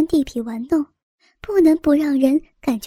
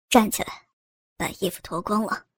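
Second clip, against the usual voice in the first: low, quiet order; a man orders the woman, low and quiet; cold command; 6 semitones lower, 6 dB quieter than usual. A narrator's reading. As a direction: give the order low and flat-voiced. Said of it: "命令".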